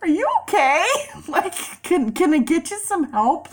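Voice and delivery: high, sugary fake-concerned voice; mock concern; mock sweet concern. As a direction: play it overly sweet and helpful. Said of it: "So I was just like".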